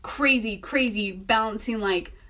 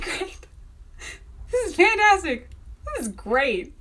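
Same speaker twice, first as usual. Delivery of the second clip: sarcastic, pitched higher; 8 semitones higher than usual. Retold or imitated. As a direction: fake cheer over a disaster. Sarcasm at everything going wrong.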